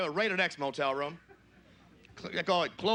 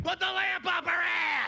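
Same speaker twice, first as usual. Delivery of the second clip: put-on high, drawn-out kid's scream; little kid yelling; 13 semitones higher, slower than usual. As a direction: shriek it slowly like a small child. Little kid yells the screamed line.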